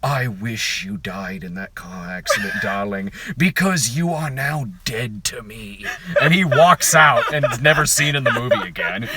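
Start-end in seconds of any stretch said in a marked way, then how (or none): 0.0-5.8 dramatic farewell. Retold or imitated. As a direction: deliver it like a campy melodrama. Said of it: "Daniel Roebuck goes".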